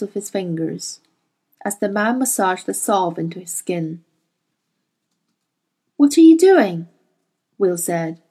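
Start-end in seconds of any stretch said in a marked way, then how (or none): 6.0-6.9 put-on high, loud frightened boy. A narrator's reading. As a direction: cry out in alarm as a young boy.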